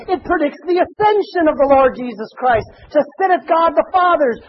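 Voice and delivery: fervent revival shout; preaching at full force. Acted. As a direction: lift it up and proclaim.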